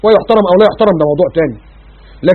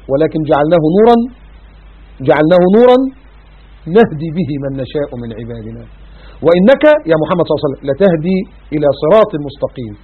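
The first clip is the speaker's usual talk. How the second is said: Quran recitation; 4 semitones lower than usual. Acted. Recites verse.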